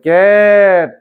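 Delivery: loud ক্যাট with a long "ae"; jophola vowel. Shown as a demonstration. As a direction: stretch and project the vowel in ক্যাট. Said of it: "উচ্চারণটা যেমন জফলার মতো আছে".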